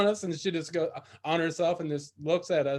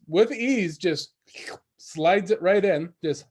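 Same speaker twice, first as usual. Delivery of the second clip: normal talking voice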